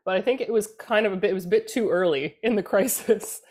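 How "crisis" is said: ordinary talk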